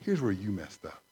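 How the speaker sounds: voiced low and soft, gently correcting; calm divine reproof, spoken low; a soft, low fatherly correction; gentle God. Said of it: "he reminds me gently".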